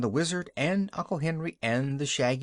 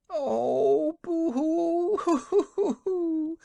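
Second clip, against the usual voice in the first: high, loud boo-hoo sobbing; 4 dB louder, much slower than usual. Acted. Wife breaks into fresh sobs, high boo-hoo crying. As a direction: blubber it out in a fresh burst of grief. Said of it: "sobbed the woman".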